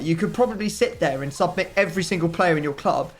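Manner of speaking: usual speaking voice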